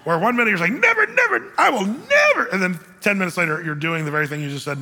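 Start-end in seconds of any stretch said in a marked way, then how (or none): 0.8-2.5 high-pitched, hammed-up cry of refusal; mock vehement shout. Mimicking a Pendulum Pete refusing, high mock shout. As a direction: shoot way up in pitch on each "never".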